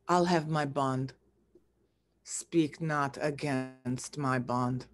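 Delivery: cold refusal. Acted. Shylock shutting down Antonio's plea, low and cold. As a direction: hold back the anger, quietly final.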